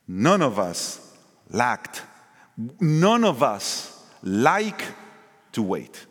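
slow, deliberate None of us like; slow emphasis. Acted. Preacher teasing the church about waiting, slowed down. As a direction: drag each word out to land it.

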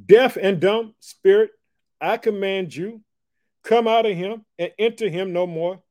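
slow, loud, high rebuke of the spirit; commanding Jesus. Acted. Jesus commands the spirit out, slow and loud. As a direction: command it out, word by word. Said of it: "He said this".

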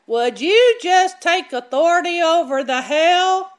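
calling out. Retold or imitated. Church elder calling loudly over the hailstorm. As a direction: shout it over the noise of the hail. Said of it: "he just calls out".

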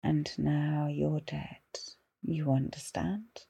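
slow, soft, menacing whisper; ghostly whisper. Retold or imitated. Ghost leaning down to the stage manager, slow whisper. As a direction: lean in close and breathe the threat slowly. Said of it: "Just quietly leans in and just says".

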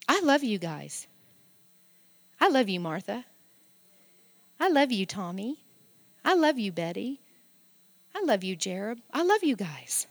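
soft, sincere affection; tender. Real, not acted. Pastor tells members by name, tender and soft. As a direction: speak love to each person gently.

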